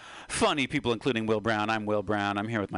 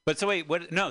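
ordinary talk, nothing marked

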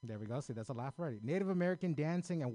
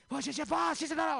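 high, loud mock French babble; shrill mock French babble; 13 semitones higher, 7 dB louder, faster than usual. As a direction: parody the guard's French, pitched up and loud. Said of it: "me and him got into it".

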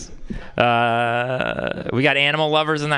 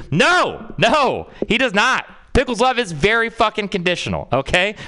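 heated rant; 8 semitones higher than usual. Real, not acted. Ranting back at the aunt's claim.

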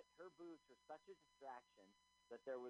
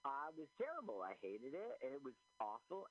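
emphatic; 8 dB louder than usual. Real, not acted. Emphatic verdict on movie.

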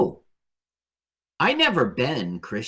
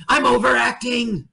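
high, exaggerated theatrical declaration; hammy stage actor; 10 semitones higher than usual. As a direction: ham it up in a silent film. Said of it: "he would go".

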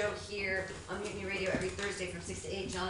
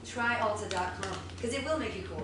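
upbeat, louder sponsor pitch; ad read; 3 semitones higher, 4 dB louder than usual. Acted. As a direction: sell it like a radio ad. Reads a sponsor ad.

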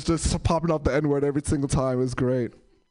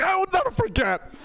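high, overblown declaration; mock-patriotic vow. Customer answering cashier about nine eleven, high and overwrought. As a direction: swear it with hammed-up fervor.

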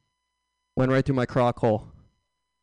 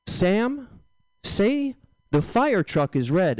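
mom voice; 6 semitones higher, much slower than usual. Retold or imitated. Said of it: "My mom would be like".

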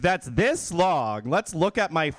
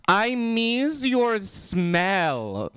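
sultry lover voice; 7 semitones higher, much slower than usual. Retold or imitated. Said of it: "he's like".

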